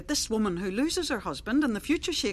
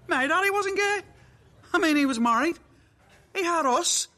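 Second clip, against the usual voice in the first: higher, louder, laughing in disbelief; nervous laugh; 7 semitones higher, 4 dB louder than usual. Acted. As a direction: laugh it off, rattled. Laughing off the news.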